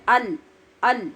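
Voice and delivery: ال with a light, thin hamza. Shown as a demonstration. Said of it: "اس کو کہنا ہے".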